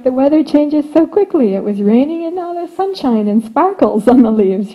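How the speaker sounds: normal talking voice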